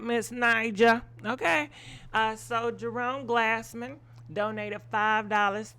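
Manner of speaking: usual speaking voice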